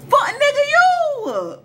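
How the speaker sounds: very high, loud accusing yell; outraged shout. Acted. Teller answering who could have took it, outraged shout. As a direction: fling the blame back at full pitch.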